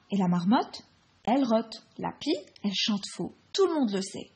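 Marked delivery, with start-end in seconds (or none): none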